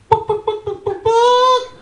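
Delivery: high clucking hen noise; chicken clucking. Acted. Imitating chicken for eggs.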